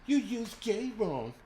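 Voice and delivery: high, drawn-out whiny mimicry; mock the complainer in a high, slow voice; exaggerated high-pitched imitation of a complaint; mocking a complaining viewer. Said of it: "She was like".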